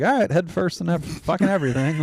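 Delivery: put-on reckless kid voice. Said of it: "Those kids are just like".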